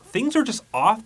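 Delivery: usual speaking voice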